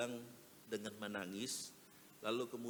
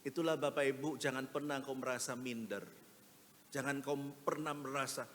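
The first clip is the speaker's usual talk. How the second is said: normal talking voice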